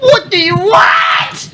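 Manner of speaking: high, loud, slow mock Jamaican shout; Jamaican accent. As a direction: bellow it in a hammy Jamaican accent. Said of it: "Do the Jamaican accent".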